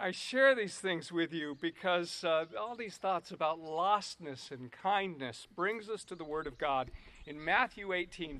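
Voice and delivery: usual speaking voice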